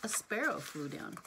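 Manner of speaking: ordinary delivery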